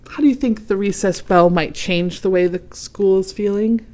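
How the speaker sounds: plain reading voice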